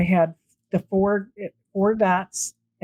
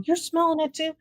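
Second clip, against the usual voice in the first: surprised; 9 semitones higher than usual. Retold or imitated. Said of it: "And I go".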